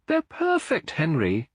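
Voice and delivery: lifted voice of a wife in awe of her babies; Eleanor's tender line. A narrator's reading. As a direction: voice her as a hushed, marvelling young mother. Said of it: "she whispered".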